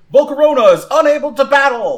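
high, loud, quick announcement; referee calling out. Acted. Referee announces a knockout to the arena, calling out loud. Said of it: "the referee then calls out".

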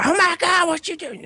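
very high mock-female squeal; imitated yelling woman. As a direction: mimic her frantic yelling in falsetto. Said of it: "she's yelling at the guy".

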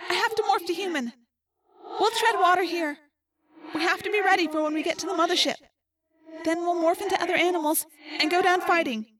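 put-on high, distant girl, resigned but resolute; trapped Rachel. A narrator's reading. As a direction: lay out the last stand in a high voice. Plays trapped Rachel in narration.

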